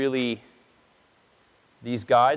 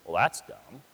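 dismissive scoff; 7 semitones lower, 3 dB quieter, slower than usual. Retold or imitated. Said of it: "and we think".

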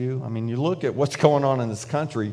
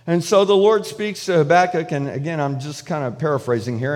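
ordinary talk, nothing marked

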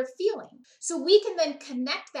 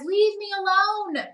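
a student pushing the teacher away, pitched higher and slow; slow, higher sulky kid; lightly voiced sulky student telling the teacher to back off; upset student; 7 semitones higher, 4 dB louder than usual. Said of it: "I might have a student that says".

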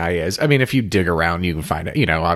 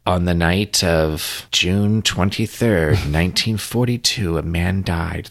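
slow, deadpan Australian narrator, laughing through it; mock Australian accent; 3 semitones lower, slower than usual. Describing a favorite podcast host, a lightly mock Australian accent. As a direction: read it like a grim Australian true crime host. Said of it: "That's my Australian accent".

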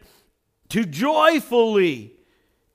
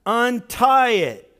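"untie it" slow and louder, UNTIE hit hard; stress on untie; 3 semitones higher, 6 dB louder, slower than usual. Shown as a demonstration. Pastor repeating the command so the church hears, slow loud stress. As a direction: punch "untie" so they catch it. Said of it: "I want you to hear that".

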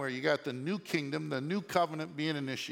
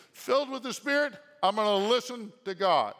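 ordinary delivery

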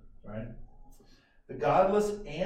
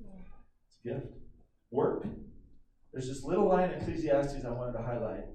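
normal talking voice

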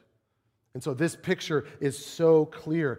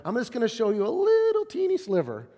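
higher, light playful voice of God; God showing a teeny sliver of power, playfully higher; 8 semitones higher than usual. Acted. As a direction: tease them with just a tiny sliver. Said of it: "God going".

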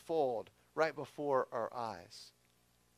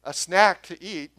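mock casual; 3 semitones higher, 11 dB louder than usual. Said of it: "what he's saying isn't".

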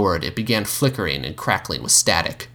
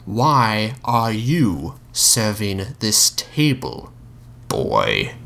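put-on very slow, menacing question, voice dropping on boy; the Mouth's slow demand; much slower than usual. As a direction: drag it out to intimidate.

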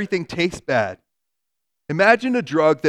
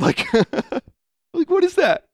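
higher, laughing incredulity; laughing disbelief; 13 semitones higher than usual. Real, not acted. Preacher laughing at the absurd answer, much higher. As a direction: laugh through the question in disbelief.

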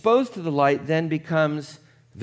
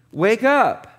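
higher, louder call; a sharp wake-up call; emphatic wake up; 6 semitones higher, 6 dB louder than usual. Acted. Preacher calling the congregation to wake up, emphatic.

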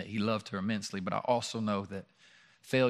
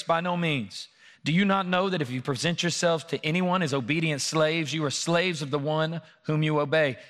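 scripture read with rising preacher fervor; a higher, louder, urgent sermon read; preaching at full force; 4 semitones higher, 3 dB louder than usual. Acted. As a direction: build the passage like a pulpit cry.

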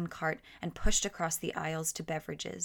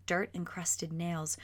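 usual speaking voice

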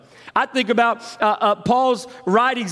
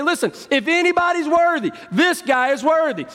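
higher and fast, selling the case to Jesus; pushy advocates; 10 semitones higher than usual. Retold or imitated. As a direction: insist like eager lobbyists. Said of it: "they say".

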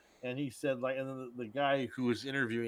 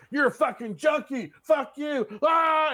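screaming at the junkie, pitch way up and loud; furious shout; 12 semitones higher, 10 dB louder, slower than usual. Retold or imitated. Franco's character yelling at his neighbor, furious shout. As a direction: blow up and yell it all out. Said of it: "and he's just like".

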